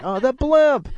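put-on high, excited kid's cry; a child's sudden thrilled yell; excited shout. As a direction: spot it and squeal with delight. Kids spotting the blimp.

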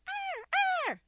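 high, loud imitated parrot squawk; screeching parrot cry. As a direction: squawk like an angry bird.

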